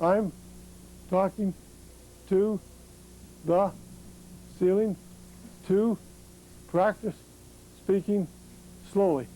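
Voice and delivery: each word spaced out, slow and deliberate; practising slow speech to the ceiling, word by word; speaking slowly. Retold or imitated. Lying in bed, practising speech for the therapist, very slow. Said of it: "she told me that I'd lay in bed".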